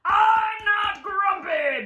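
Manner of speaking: slow loud grumpy yell; grumpy shout. Shown as a demonstration. Storyteller demonstrates for kids, a grumpy yell. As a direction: show the kids how to shout it. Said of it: "Can you do that right now?".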